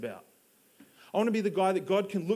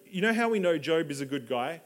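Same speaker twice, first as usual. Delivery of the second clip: ordinary talk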